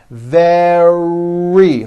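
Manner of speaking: "Very" drawn out through a strong middle R; tongue back, push and hold the R in "Very". Shown as a demonstration. Teacher says like that, "Very" with a long pushed R. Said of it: "you push out the R".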